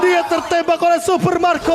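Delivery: excited play-by-play. Real, not acted. Caster calls the kill.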